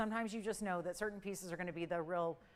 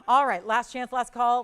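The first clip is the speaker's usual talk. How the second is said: much louder urgent call; loud last call; 3 semitones higher, 13 dB louder than usual. Real, not acted. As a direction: announce it to everyone. Host calls last chance.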